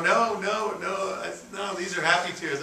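high-pitched, imitated weepy protest; lightly acted happy crying; mock tearful. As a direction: replay blubbering reassurance in a high voice. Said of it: "I'm crying uncontrollably".

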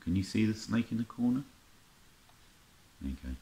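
ordinary talk

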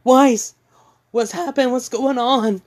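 high, loud worried call; alarmed Ruby. Retold or imitated. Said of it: "Ruby goes in there saying".

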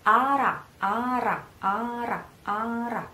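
"ara" with a light single R between two vowels, repeated as a drill. Shown as a demonstration. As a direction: model "ara" carefully, one short R between the vowels. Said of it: "between the two vowels".

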